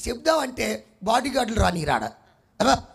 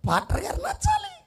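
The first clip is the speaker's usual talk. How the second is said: a jeering falsetto, much higher; mocking high voice. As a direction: mimic the flock in a high squeak. Mock voice of congregation. (ఎగతాళిగా చాలా ఎత్తైన గొంతుతో)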